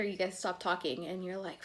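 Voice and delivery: ordinary talk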